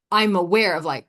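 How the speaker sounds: ordinary talk, nothing marked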